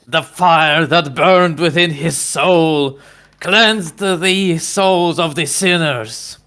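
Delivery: slow, booming preacher; pastor voice. Retold or imitated. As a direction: preach it slowly with passion. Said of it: "the passion you put in the pastor's voice".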